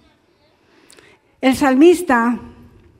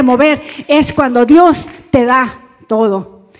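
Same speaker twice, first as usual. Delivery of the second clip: rising, louder preacher's call; loud, fervent sermon build; fervent preaching; 6 dB louder than usual. Acted. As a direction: preach it with full conviction. Preaching to the congregation.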